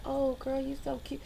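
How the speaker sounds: quiet sugary compliment; mock sweet compliment. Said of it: "It's not like they're like".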